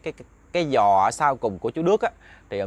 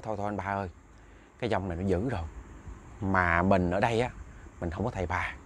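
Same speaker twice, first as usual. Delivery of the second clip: low, hushed imitation of a neighbour; subdued dissuading; 5 semitones lower, 6 dB quieter than usual. Neighbours urging the father to stop beating his son, subdued. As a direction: talk him down softly and low.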